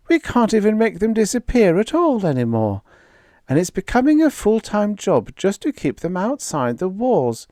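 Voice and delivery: high, fretful complaint; Vera's worried voice. Acted. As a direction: list the troubles anxiously. Vera complains of monsters.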